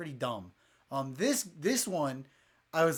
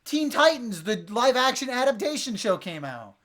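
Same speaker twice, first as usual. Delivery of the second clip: much higher and louder, bursting with excitement; excited; 9 semitones higher, 8 dB louder than usual. Real, not acted. To friends, suddenly remembering the Teen Titans show, excited. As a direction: jump in eagerly with news.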